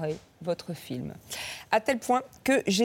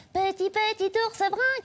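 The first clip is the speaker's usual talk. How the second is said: put-on childlike; 13 semitones higher than usual. Childlike voice for bear.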